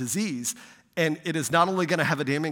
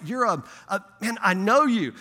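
mock-nervous stammer, pitched up; imitating stuttering nervousness; 6 semitones higher, 4 dB louder than usual. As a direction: play a tongue-tied guy fumbling for words. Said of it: "he's stuttering for words".

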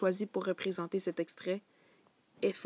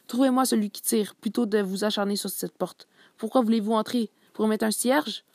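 angry scolding; 2 semitones higher, 8 dB louder than usual. Acted. Commissioner scolding his officers.

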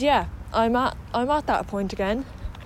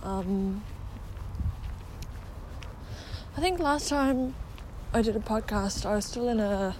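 talking around a retainer; slightly lisped, retainer in mouth; wearing a retainer; 8 dB quieter than usual. Real, not acted. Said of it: "that I have my retainer in".

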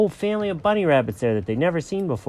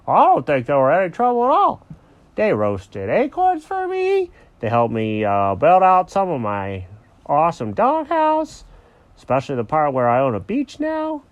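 lightly voiced, chatty cartoon dog; a hint of a boastful doghouse owner; good-natured Alfred the dog; 5 semitones higher, 5 dB louder than usual. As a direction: play an easygoing dog, lightly. Alfred the dog's voice.

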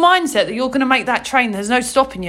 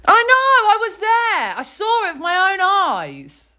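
shout; 7 semitones higher than usual. Real, not acted. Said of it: "There's no need to shout".

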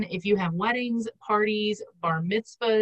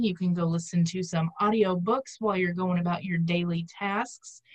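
ordinary talk, nothing marked

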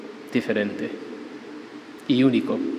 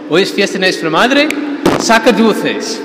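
animado; 7 semitones higher, 7 dB louder than usual. Retold or imitated. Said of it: "el sacerdote como se animó".

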